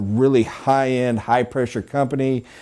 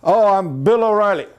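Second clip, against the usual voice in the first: customer's intro; 5 semitones higher than usual. Retold or imitated. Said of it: "he took their accent".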